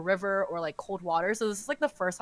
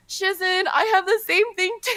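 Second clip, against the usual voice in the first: mock whiny sickly plea; 9 semitones higher, 6 dB louder than usual. Jealous disciple fakes illness.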